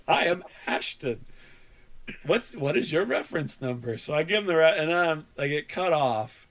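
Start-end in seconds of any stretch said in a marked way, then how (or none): 0.1-4.0 mock-careful second phone agent; imitated scripted support voice; over-enunciating. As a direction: introduce yourself word by word. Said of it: "but he is over enunciating".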